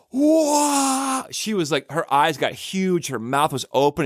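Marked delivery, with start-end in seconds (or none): 0.1-1.2 high, drawn-out gasp of awe; wide-eyed astonished whoa; stunned whoa. Retold or imitated. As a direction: act a little girl amazed at a magic trick. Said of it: "and she was stunned".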